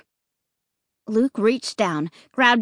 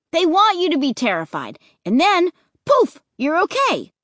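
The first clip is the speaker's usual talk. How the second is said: higher, eager insistence; excited cry; 6 semitones higher, 3 dB louder than usual. Acted. Luke eagerly explaining the scare, excited. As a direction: convince them with excitement.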